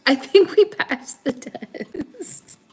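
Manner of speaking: slow, laughing it out; relieved laugh through the words; slowed down, giggling. Real, not acted.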